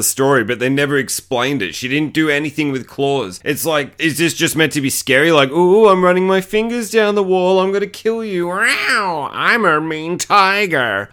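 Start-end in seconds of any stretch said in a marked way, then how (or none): 5.5-11.1 higher hammed-up monster taunt, slowing at the end; mock a silly clawing tiger killer; mocking villain. Mocking the movie's clawing ghost, hammed-up tiger taunt.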